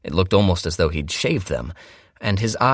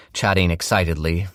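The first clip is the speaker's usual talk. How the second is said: plain reading voice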